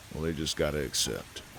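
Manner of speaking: ordinary delivery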